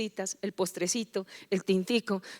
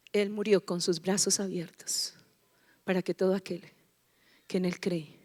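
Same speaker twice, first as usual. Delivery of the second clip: tender hushed whisper; 3 semitones lower, 4 dB quieter than usual. Acted. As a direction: let it land softly. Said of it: "susurro en la prédica". (susurro tierno)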